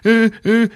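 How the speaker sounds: high-pitched fake sobs; mock crying. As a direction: whimper like a crying kid. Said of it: "he's, like, fucking crying".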